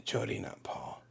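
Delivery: a quick whispered self-correction; whispered aside. Real, not acted. Host correcting a name under his breath, whispering. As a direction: breathe it to yourself, off-mic.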